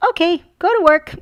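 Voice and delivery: high, loud chirpy child imitation; daughter's voice. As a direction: chirp it like a cheerful kid. Mom voicing daughter in story.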